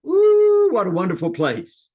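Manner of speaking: very high, loud ooh, then gushing praise. Shown as a demonstration. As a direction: sell it like you love the place. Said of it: "You ought to practice that".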